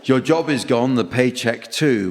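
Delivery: ordinary talk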